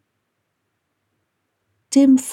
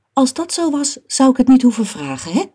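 high, quick snippy retort; 7 semitones higher, 5 dB louder than usual. A narrator's reading. Mum retorts to dad about her lost phone, snippy. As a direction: answer back testily, ending on a pointed "hè?". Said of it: "zegt mama snibbig".

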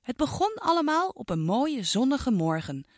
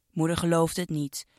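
usual speaking voice

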